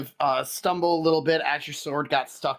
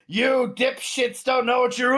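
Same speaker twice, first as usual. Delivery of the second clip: angry hobgoblin; 8 semitones higher than usual. Acted. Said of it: "he's like".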